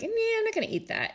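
high, mocking refusal. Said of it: "is like".

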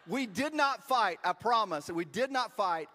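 loud, high, slow "did not fight"; emphatic denial. Real, not acted. Preacher assuring congregation about the photo shoot, emphatic denial.

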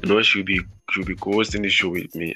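ordinary talk, nothing marked

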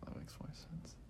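hushed, barely voiced musing; under the breath, retelling a private reaction; murmured inner thought. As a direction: whisper it as if thinking to yourself. Retells own quiet thought.

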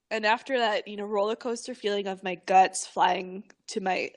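normal talking voice

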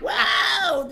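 loud high laughing cry; laughing whoa. Real, not acted. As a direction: whoop it while laughing. Laughing reaction.

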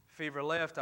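usual speaking voice

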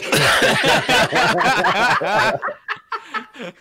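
long, high cackling laugh; real laughing fit. Real, not acted. Game master reacts to the player's dilemma, real laughing fit. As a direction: crack up helplessly at length. Table cracks up at player's question.

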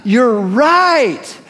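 gasp, then drawn-out amazed admission; hammed-up revelation; mock surrender. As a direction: gasp and concede as if astonished. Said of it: "throw up your hands and say".

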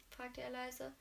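usual speaking voice

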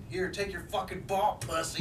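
high, drawn-out imitation of her yelling; slow, high-pitched mock yell; mock angry yelling. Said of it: "you can hear her start yelling".

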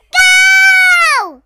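high, loud starting shout. Acted. Starter shouts the start of the race, high and loud.

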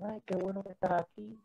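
high, soft welcome imitated; friendly greeting. Church members greeting him after the service, friendly.

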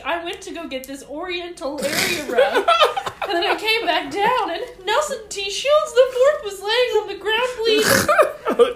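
building, hammed-up report to police; imagined friend's account. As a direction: play the upset witness, laughing through it. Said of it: "He's like".

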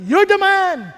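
loud, hammering repeated accusation; forceful accusation. Acted. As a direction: pound each charge home. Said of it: "sabi niya". (malakas at paulit-ulit na pagsumbat)

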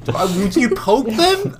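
high, slow disbelieving question; incredulous. Real, not acted. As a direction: sound amazed anyone would do that.

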